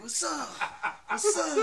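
high, loud mock challenge; a tweaker yelling back at the car; imitating tweaker. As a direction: puff up like a tweaker calling out. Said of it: "he was like".

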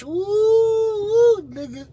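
very high, loud teasing ooh; excited hoot. Real, not acted. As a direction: hoot at him like it's a scandal. Teasing [name] about his wife.

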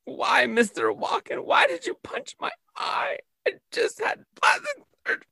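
high, soft whiny wail; hammed-up squeaky wail at Walken; mock whining victim. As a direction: whimper it like a pampered victim.

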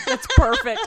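high laughing burst. Real, not acted. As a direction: laugh out the words. Laughing at chewing guest.

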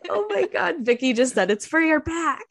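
high, giggling through words; can barely talk for laughing; laughing hard. Real, not acted. Laughing hard at Vicky's remark.

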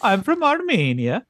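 put-on high, slow Armenian accent. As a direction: play the Armenian with a thick accent. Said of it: "that's really good".

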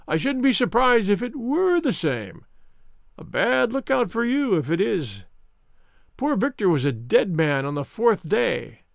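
high-pitched, self-satisfied villain; Mister Smith, smug. Acted. Visitor who sniggered at sick Holmes, smug. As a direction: sneer at the helpless patient. Narrator voices sneering visitor.

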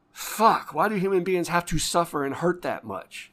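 loud, high outburst of grief; real anguish; anguished cry. Real, not acted. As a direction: cry out at the unfairness. Grief over a dead patient.

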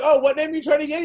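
playing innocent with the tour manager; mock innocent. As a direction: play dumb sweetly. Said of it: "I'm like, I'm like".

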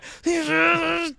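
high, loud straining squeal; hammed-up strained shriek; imitated patient's cry. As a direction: mimic the agonised man next door. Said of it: "I'm hearing the guy".

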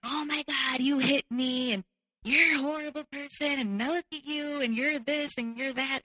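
high, imitating a wounded complainer; mock outraged accusations. Boyfriend's outraged accusations, acted out.

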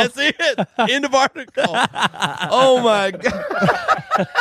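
high, squealing through real laughter; crying with laughter. Real, not acted. Telling friends the article is over, crying with laughter. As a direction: gasp it out while cracking up. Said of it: "I promise I'm crying here".

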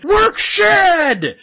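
high, loud mock yell from the crowd; rowdy crowd shout; fan scream. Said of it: "fans are just screaming things".

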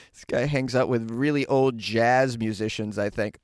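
ordinary delivery

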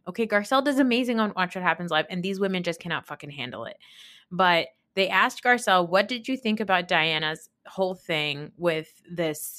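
ordinary talk

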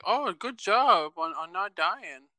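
slow, high mocking praise; sarcastic. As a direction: drag out the fake praise. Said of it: "they're like".

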